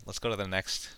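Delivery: usual speaking voice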